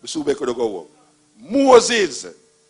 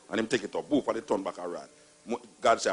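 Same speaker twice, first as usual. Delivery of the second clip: ordinary talk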